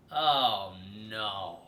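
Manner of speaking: low mock dismay. Reacts to bully in story.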